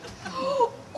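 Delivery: sharp high gasp of realisation; theatrical intake of breath; mock gasp. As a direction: gasp as if struck by an idea. Sudden idea.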